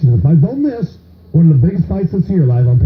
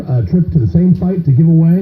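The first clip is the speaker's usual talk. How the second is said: ordinary delivery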